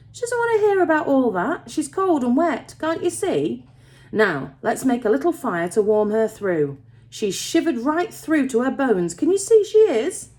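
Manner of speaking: put-on high, insistent young man's reproach; protective scolding. A narrator's reading. Young miner telling father the girl is cold, protective scolding. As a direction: urge him to notice, pitch well up.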